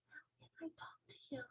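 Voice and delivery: barely audible whisper; tiny, hushed voice; whispered question. Acted. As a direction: whisper as softly as you possibly can. Sophie whispers lower.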